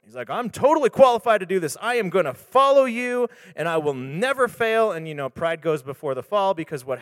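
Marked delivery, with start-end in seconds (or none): 0.3-4.9 loud, fast, higher boast of overconfidence; overconfident boast. Retold or imitated. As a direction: swagger through it like Peter showing off. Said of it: "like, overconfident".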